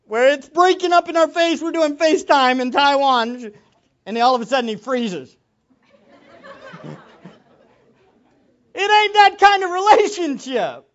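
loud, high, fervent preaching; impassioned sermon cry; preaching at full force. Real, not acted. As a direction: hammer every word to the congregation.

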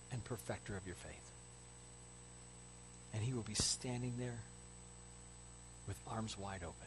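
very soft, low, reverent delivery; awed, hushed sermon payoff; hushed preaching. Real, not acted. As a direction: drop to a near-whisper, with long pauses.

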